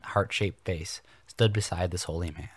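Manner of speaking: ordinary delivery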